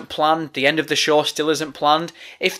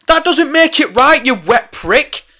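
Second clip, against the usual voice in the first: higher and louder, snapping at a commenter; heated retort; 7 semitones higher, 5 dB louder than usual. Real, not acted. Podcaster answering a Twitter commenter, heated and loud. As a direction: fire it back at the troll. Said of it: "I'm getting heated".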